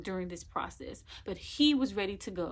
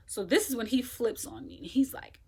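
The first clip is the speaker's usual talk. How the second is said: ordinary delivery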